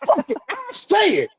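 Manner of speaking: very high-pitched, insistent repeats; worked-up defiance. Real, not acted. Podcast guest daring himself to speak out, worked up. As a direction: push it out high and agitated.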